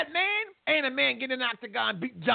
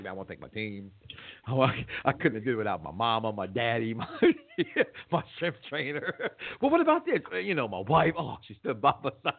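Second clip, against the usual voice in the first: imitating Jon Jones; 11 semitones lower than usual. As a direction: play the grateful fighter, half-laughing. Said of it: "he was like".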